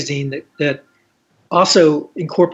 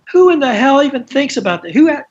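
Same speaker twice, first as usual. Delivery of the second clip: high, louder mock outrage from an old lady; imitating Iris; 10 semitones higher, 4 dB louder than usual. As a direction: toss it off like a scandalised grande dame. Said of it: "she's going".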